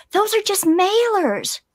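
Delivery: high, drawn-out pleading explanation; retold protest. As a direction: act out explaining yourself to a mugger. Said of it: "And I said".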